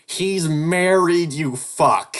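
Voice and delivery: loud, high, dragged-out outburst; a loud groaning complaint at bad luck; frustrated yell. Real, not acted. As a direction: blurt it out in exasperation.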